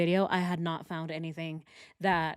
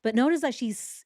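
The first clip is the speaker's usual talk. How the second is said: ordinary talk, nothing marked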